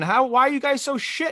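high, mocking reporter's whine; a mocking high voice lampooning the reporter; mock reporter question.

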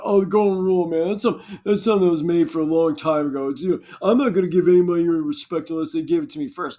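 loud, fast sneering parody; loud fast mock smugness; mocking the selfish. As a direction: rattle it off like a smug jerk.

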